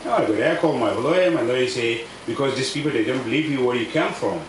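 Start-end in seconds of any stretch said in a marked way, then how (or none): none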